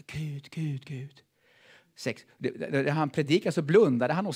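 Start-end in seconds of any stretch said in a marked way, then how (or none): 0.1-1.2 whispered, breathy calling on God; whispered prayer. Retold or imitated. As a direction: whisper the name like a plea. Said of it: "han bad och sa". (viskad bön)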